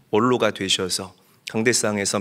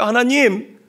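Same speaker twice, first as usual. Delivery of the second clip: loud, high wailing plea to God; crying out to God; 13 semitones higher, 9 dB louder than usual. Acted. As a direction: cry out in desperate anguish, half out of her mind. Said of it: "하나님께 소리내어서 우는 거예요". (하나님께 울부짖는 절박한 외침)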